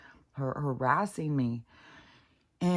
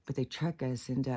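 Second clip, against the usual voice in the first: ordinary delivery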